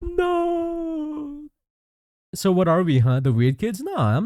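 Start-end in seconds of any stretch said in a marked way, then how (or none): 0.0-1.4 mock anguished cry. Crying out in mock denial.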